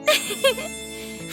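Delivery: high, pleased little laugh; soft giggle. Acted. Doctor hearing the wish to get closer, giggling. Pleased reply to affectionate confession.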